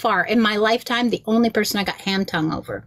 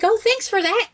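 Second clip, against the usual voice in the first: pleased celebrity; 12 semitones higher than usual. Retold or imitated. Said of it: "And he was like".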